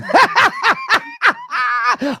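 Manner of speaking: a sudden high burst of laughter; loud laugh. Real, not acted. Cracking up at the rhyme read aloud, loud laugh.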